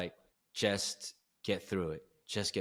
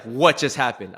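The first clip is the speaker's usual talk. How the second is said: loud, startled mock disbelief; freaked-out question; 3 semitones higher, 12 dB louder than usual. Wife reacting to the sudden switch, loudly startled. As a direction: blurt it out as a shocked wife.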